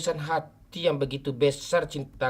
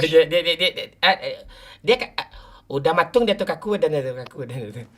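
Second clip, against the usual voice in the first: excited teasing; 6 semitones higher, 7 dB louder than usual. Real, not acted. Teasing friend who won't talk.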